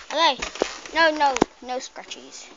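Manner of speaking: usual speaking voice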